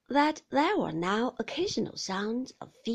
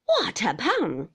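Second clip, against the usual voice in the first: sharp, louder question, drawn out slowly; 7 dB louder than usual. A narrator's reading. Aunt cutting into the visitor's congratulations, sharp. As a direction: demand it sharply, loud and slow. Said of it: "said my aunt sharply".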